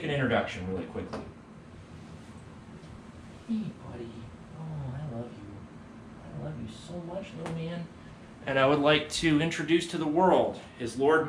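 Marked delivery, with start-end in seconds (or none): 3.5-7.9 soft pet talk, much quieter; cooing to the cat. Real, not acted. Teacher softly coos to his cat Osiris.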